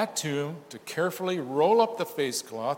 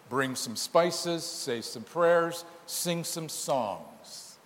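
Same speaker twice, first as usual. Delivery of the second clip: slowing down; much slower than usual. Real, not acted. Lists empty rituals, slowing.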